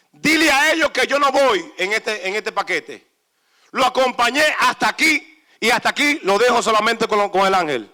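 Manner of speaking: booming divine command, fast at times; voice of God. Acted. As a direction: speak as God, firm and loud.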